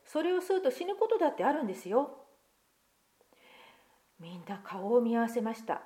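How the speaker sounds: put-on lively picture-book reading to children; story narration. Pastor reading a picture book to children, lively storytelling. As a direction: read the tale aloud to the congregation, expressive.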